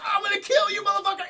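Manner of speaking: high, very loud attack yell; furious battle cry. Retold or imitated. Retelling Mac leaping at whatever sprang his trap, furious shout. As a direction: scream the threat while charging.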